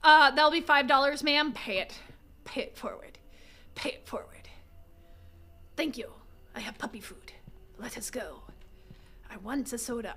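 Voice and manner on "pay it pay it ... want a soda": unvoiced whisper; soft secretive whisper. Real, not acted. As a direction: breathe the words. Whispering while sneaking.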